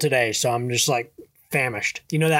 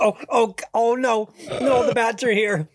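mock panic; 6 semitones higher than usual. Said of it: "being so afraid he can't leave".

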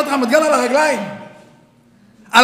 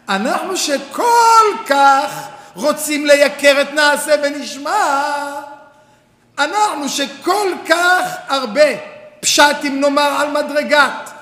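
slow, loud, drawn-out build; impassioned preaching; 3 dB louder, slower than usual. Real, not acted. Rabbi rousing his students, slow impassioned preaching. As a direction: preach the wonder.

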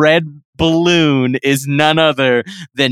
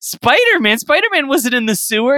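mock disbelief; 9 semitones higher than usual. Sister doubting brother.

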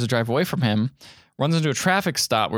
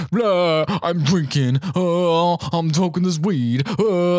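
higher, goofy blurting; dopey mock carousing; 4 semitones higher, much slower than usual. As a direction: imitate a clownish party guy, with silly noises. Mimicking Farley drinking with kids.

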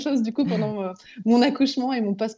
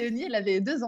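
usual speaking voice

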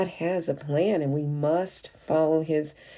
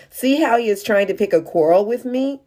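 higher, louder accusing; suspicious outburst; 6 semitones higher, 5 dB louder than usual. Acted. King of Israel to his court, higher and louder. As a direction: sound sure he is being baited. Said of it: "he tore his robes and said".

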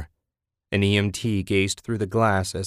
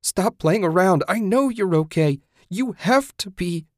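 high, loud desperate insistence; frantic plea to her dead friend; frantic shouting; 10 semitones higher, 4 dB louder than usual. A narrator's reading. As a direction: shout through denial. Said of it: "Susan shouted".

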